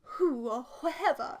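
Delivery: lightly voiced posh lady, slow; drawn-out hint of a posh client; mild posh lady impression. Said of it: "she's a very posh lady".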